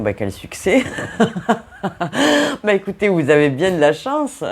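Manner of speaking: laughing through the words, high and loud; tickled, voice lifted by laughter; amused laughing. Real, not acted. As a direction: tease them between bursts of real laughter. Amused by two men appearing.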